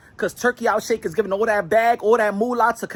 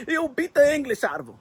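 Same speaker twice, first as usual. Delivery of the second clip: ordinary talk, nothing marked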